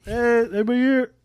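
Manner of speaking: high announcing voice. Retold or imitated. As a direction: call out so dad isn't startled. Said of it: "there's been times where I'm like".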